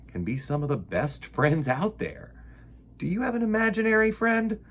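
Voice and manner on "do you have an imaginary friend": usual speaking voice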